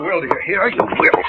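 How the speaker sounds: husband threatens wife, hissing command; menacing command. Acted. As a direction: force her with a menace. Husband forcing wife to come.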